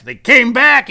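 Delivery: high, loud exclamation; emphatic repeat. Retold or imitated. Same words repeated with force.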